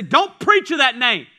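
loud, forceful prohibition; acted command from the authorities; stern order. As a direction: lay down the law loudly. Authorities forbid apostles, acted order.

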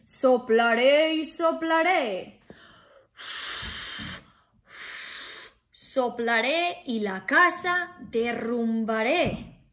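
loud booming wolf threat; threatening wolf. Acted. Wolf threatening to blow the pig's house down, loud. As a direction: huff it out to scare them. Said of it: "Y el lobo dijo".

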